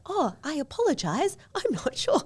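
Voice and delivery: high-pitched imitation of an apologetic AI; apologetic assistant, sent up high; mock apology. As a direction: squeak out a put-on, sheepish apology. Mimicking the AI caught out.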